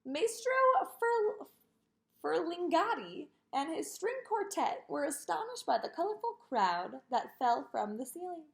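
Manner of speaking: ordinary delivery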